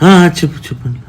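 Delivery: high, loud "yes, tell me"; husband answering his dying wife, high and loud; eager response. Acted. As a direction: jump at her words.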